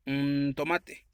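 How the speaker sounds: ordinary delivery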